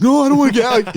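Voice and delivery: high, loud mock panic; yelped, hammed-up protest; scared refusal. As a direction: imitate a frightened kid refusing. Scared boy refuses uncle.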